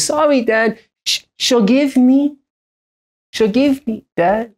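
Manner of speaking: put-on high, slow child voice; toddler plea. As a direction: imitate a three-year-old asking forgiveness. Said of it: "She says".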